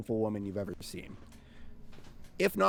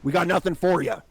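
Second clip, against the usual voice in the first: lightly gruffed shouted warning; a hint of a gruff innkeeper; 5 semitones higher, 10 dB louder, faster than usual. A narrator's reading. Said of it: "a gruff voice shouting from the inn".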